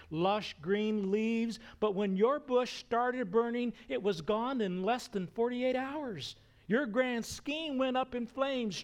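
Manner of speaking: high, emphatic preacher's voice of God; God chiding Moses. Acted. God recounts Moses' forty years as a bush, chiding emphatically.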